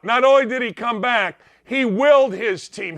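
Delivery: usual speaking voice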